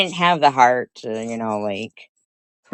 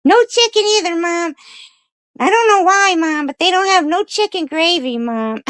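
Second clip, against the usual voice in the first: very high dog voice pleading its case to mom; talking dog; 3 dB louder than usual. Acted. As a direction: explain it as a squeaky talking dog. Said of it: "he said".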